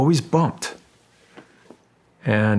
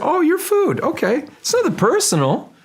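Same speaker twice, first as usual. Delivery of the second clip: much higher, casual shark shrugging it off; hammed-up shark, offhand; mock shark voice; 3 dB louder than usual. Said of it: "he said".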